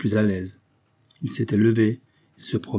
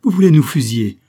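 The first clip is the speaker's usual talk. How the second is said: loud, higher horrified question; alarmed protest; 6 semitones higher, 11 dB louder than usual. A narrator's reading. Mother asks the representative about being shot, loudly alarmed. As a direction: cry it out in alarm.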